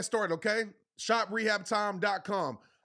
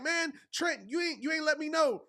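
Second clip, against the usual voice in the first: higher-pitched mock whine from a fan who missed out; complaining viewer; 7 semitones higher than usual. Teasing fans who miss the drop, mock higher whine. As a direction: play a fan grumbling they weren't told, pitched up. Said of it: "Y'all gonna be like".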